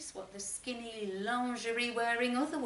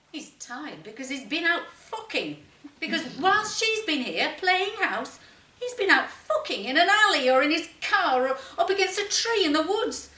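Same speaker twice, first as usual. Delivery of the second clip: building to a furious, much louder rant; bitter outburst; 6 semitones higher, 14 dB louder than usual. Acted. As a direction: spit it out in jealous rage, getting louder. Jealous fury at husband's affair.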